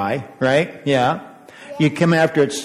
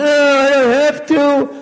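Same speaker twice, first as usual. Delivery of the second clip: high, slow whining refusal; whiny kid; 12 semitones higher, 5 dB louder than usual. Retold or imitated. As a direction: drag it out like a sulky child. Acted child refusing chores.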